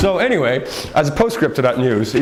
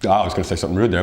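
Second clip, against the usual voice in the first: ordinary talk, nothing marked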